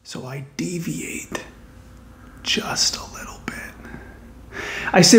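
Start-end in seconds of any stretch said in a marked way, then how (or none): 0.0-3.5 hushed, slow stage whisper; conspiratorial whisper. Real, not acted. Comic confessing a small detour to the audience, conspiratorial whisper. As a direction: whisper it like a guilty little secret. Confiding a sneaky deviation to audience.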